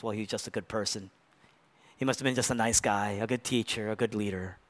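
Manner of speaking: dismissive shrug. Retold or imitated. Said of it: "the one thing you can't dare say is".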